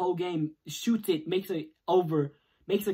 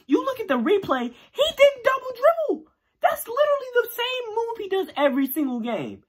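much higher and louder, furious at the refs; outraged yell; 5 dB louder than usual. Real, not acted. Fan yelling at viewers about a bad double-dribble call, outraged. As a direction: shout your disbelief at the replay.

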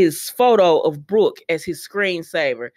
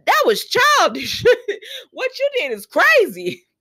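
much higher, slow put-on scolding voice with a laugh; mock outraged Steffi; 13 semitones higher, slower than usual. Steffi scolding Thomas over his tricks, high mock outrage. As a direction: play Steffi shocked and scolding, breaking into a laugh. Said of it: "Steffi is like".

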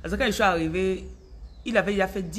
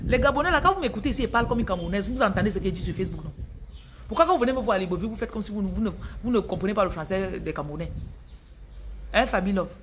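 rapid-fire Cameroonian French; Cameroonian accent; faster than usual. Shown as a demonstration. Coach repeating her complaint to Gabonese listeners, in quick Cameroonian accent. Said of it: "En camerounais maintenant".

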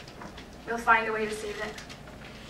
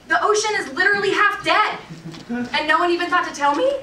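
louder and higher, indignant disbelief; raised indignant voice; outraged; 6 semitones higher, 6 dB louder than usual. Acted. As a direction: blurt it out, scandalised. Repeats complaint, now outraged.

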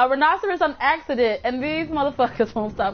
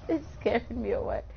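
normal talking voice

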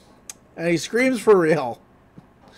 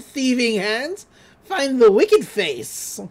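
high, slow, eerie witch voice; witch curse; 5 semitones higher than usual. Retold or imitated. Witch's face in the watch cursing Stan, eerie and high. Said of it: "She's like".

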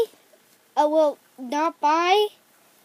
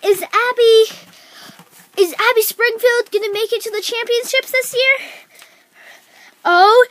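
excited higher play-by-play voice; sports commentator; 6 semitones higher, 4 dB louder than usual. Acted. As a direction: call the race like an announcer. Commentates the horse show.

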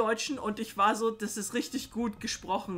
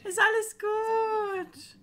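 soothing a pet; 9 semitones higher than usual. Real, not acted. Calming the scared dog.

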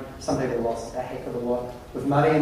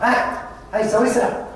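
raised call across the room; 9 semitones higher than usual. Retold or imitated. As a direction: call out to get attention. Client calling out from dock.

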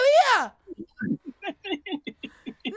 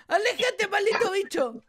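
ordinary delivery

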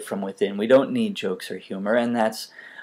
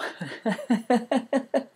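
cartoon laugh; 13 semitones higher than usual. Real, not acted. End-of-video laugh.

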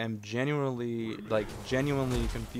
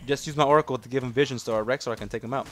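ordinary talk

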